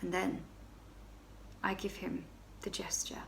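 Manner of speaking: breathy hushed storytelling; whispered confession. Acted. Baroness whispering the flirtation to her friend. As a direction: whisper it as if someone might overhear.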